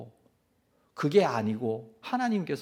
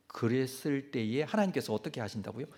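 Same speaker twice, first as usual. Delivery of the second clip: normal talking voice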